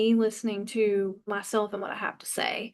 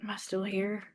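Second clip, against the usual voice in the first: whispered, barely voiced; a scared whisper to herself; frightened whisper; 3 semitones lower, 6 dB quieter than usual. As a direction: whisper it like you expect to be struck dead. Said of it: "I actually stood there like".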